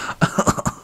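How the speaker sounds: airless wheeze of laughter; breathless silent laugh. Real, not acted. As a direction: laugh with no voice behind it. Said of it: "I'm crying".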